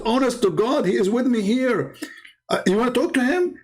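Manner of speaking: high-pitched imitation of an eager husband; mock-frantic, high voice; excited pleading. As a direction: act out someone desperate to be believed.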